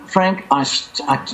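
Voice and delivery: normal talking voice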